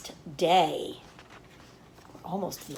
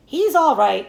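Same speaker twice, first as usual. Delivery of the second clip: a raised, higher cry of reassurance; cried out; 5 semitones higher, 6 dB louder than usual. A narrator's reading. Farmer crying out to the announcer, raised voice. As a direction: cry it out to the crowd. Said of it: "cried Mister Zuckerman".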